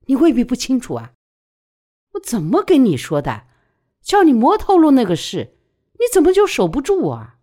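loud, high reproach; heated, accusing questions; angry scolding. A narrator's reading. Friend scolds her over a secret.

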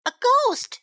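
high, loud gasp of alarm; startled cry. A narrator's reading. Sam tells Stella he sees a ghost, startled cry. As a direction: blurt it out, pitched right up. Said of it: "cried Sam".

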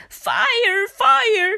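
high, loud cry of terror; screamed alarm. A narrator's reading. Pig running out of the burning house, screaming. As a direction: raise the alarm in panic. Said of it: "she screamed".

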